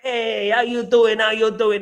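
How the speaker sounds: lightly voiced sitcom catchphrase, drawn out; slow, put-on catchphrase greeting; Fonzie. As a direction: stretch the "Hey" like a cool greaser. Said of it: "he used to be like".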